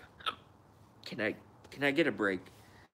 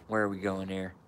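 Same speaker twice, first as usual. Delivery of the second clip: ordinary talk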